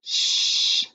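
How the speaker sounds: long hushing "shh"; shushing. Shown as a demonstration. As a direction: shush someone in a library. Reads out a library shushing sentence.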